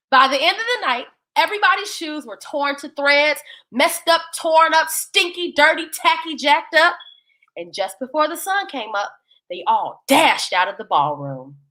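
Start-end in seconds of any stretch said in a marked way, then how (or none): none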